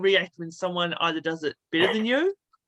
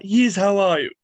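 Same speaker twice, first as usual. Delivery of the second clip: louder, lightly voiced cartoon baby; Stewie Griffin; 7 dB louder than usual. Comic retelling a requested impression for a fan's daughter, louder cartoon voice. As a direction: punch it out as the animated infant. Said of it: "my daughter loves Stewie Griffin".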